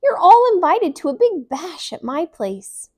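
high, loud invitation; shouting invite. A narrator's reading. Emmy shouting to everyone on the escalator, high and loud. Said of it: "she shouted".

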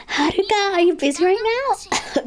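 high, bright call to a friend; cheery classmate. Acted. Voicing a classmate calling out.

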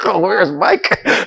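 slow, high mock question; teasing high-pitched imitation, through amusement; mock lost listener. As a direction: draw it out like a clueless reader.

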